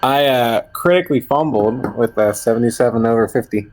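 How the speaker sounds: ordinary delivery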